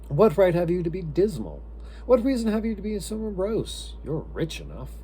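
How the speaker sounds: high, loud, cheerful retort; gaily. A narrator's reading. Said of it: "the nephew gaily".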